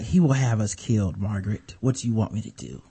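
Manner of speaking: low, soft, helpless reply under the breath; mock henpecked husband. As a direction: play a husband quietly pleading with his wife. Kraft answering his nagging wife.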